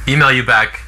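ordinary talk

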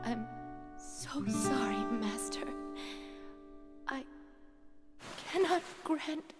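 soft, broken last words; dying, weak. Acted. Dying genie refusing Master's wish, faint and halting. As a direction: let the breath fail between words.

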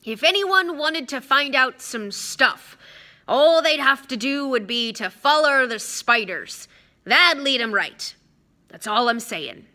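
loud, slow, pointed hint; gruff voice spelling it out for hidden listeners; deliberate, meaningful announcement; Hagrid, careful. Acted. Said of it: "said carefully".

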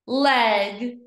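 LEG joined in one go; blended word. Shown as a demonstration. Teacher blending for pupils, LEG as one word. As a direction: say LEG whole and firmly. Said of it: "read each word fast".

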